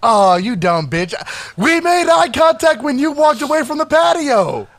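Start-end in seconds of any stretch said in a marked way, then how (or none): 1.6-4.7 high-pitched, exasperated protest; indignant retort. Real, not acted. Friend correcting the other's memory, indignant retort. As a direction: insist on it, pitched way up.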